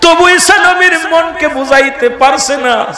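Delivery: high shouted sung cries to Allah; impassioned preaching. Acted. Preacher wails the prophet's grief to the gathering, high and shouted. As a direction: cry out the story at full force.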